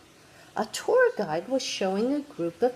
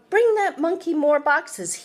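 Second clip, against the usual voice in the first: loud, higher fast shout; yelling; 8 semitones higher, 6 dB louder than usual. Acted. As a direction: bark the order across the room. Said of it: "Someone noticed and yelled".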